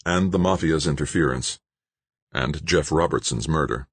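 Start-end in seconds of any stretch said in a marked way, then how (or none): none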